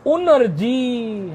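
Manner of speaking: उनर्जी very slow, un-r-ji; n before r. Shown as a demonstration. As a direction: stretch उनर्जी out with the n clearly first. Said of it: "ना पहले बोल देना". (उनर्जी धीरे-धीरे, नकार पहले)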